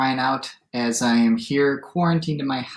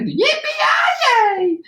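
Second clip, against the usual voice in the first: high-pitched, whooping cowboy cheer; cowboy shout; 5 dB louder than usual. A narrator's reading. Gingerbread cowboy leaps off the plate, high whooping shout. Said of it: "jumped off the plate and shouted".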